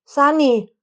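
the kneeling father calling his daughter's name in amazement; astonished king. A narrator's reading. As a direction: cry out in wonder at the impossible.

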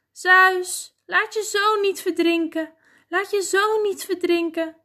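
high, sustained praying cry; desperate plea. A narrator's reading. Mother begs Zeus to save their drowning son, pleading. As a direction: call out high, then keep imploring. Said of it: "Zonder ophouden bad ze om hulp".

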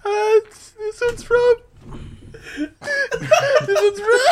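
high-pitched laughter breaking through; helpless giggling. Real, not acted. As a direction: giggle uncontrollably, can't get words out. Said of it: "Stop making me laugh!".

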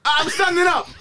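irritated protest. Real, not acted. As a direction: protest in high exasperation. Irritated protest about standing.

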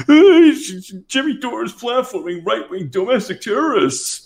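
high, loud sarcastic whine of outraged users; mocking Twitter outrage. Mocking the Twitter crowd to his co-host, high sarcastic outrage. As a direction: mimic the pearl-clutching crowd online.

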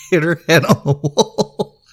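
squeezing words out through high-pitched laughter; helpless laughter. Real, not acted. Telling friend's bedroom accident story, real helpless laughing. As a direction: crack up completely while finishing the sentence. Teller cracks up at his punchline.

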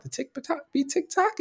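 high, playful silly voice; high playful squeak. Pretend. Playful TikTok riff.

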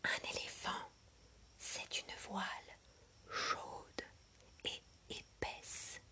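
barely audible whisper; silent whisper. Acted. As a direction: breathe it almost soundlessly. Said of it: "leur dit silencieusement".

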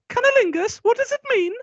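very high, loud sing-out; mock-innocent wife. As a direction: chirp it high and loud to wind him up. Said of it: "purposely go and wind him up".

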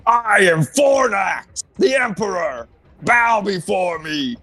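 slow, booming, high-pitched self-proclaimed emperor; booming demon lord. Acted. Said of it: "he stares you down and he's like".

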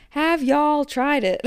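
high, drawn-out American twang; slow, hammed-up imitation of an American accent. Podcast host mimicking listener's question, playful American accent. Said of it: "say that in his accent".

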